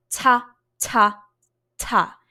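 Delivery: "ta" short with an airy T release. Shown as a demonstration. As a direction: burst each "ta" out separately. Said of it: "really short S".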